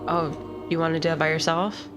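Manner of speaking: normal talking voice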